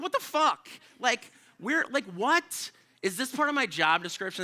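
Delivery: outraged disbelief. Retold or imitated. Outraged at being asked for cats.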